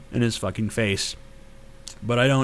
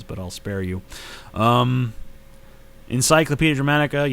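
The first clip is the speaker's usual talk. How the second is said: ordinary talk, nothing marked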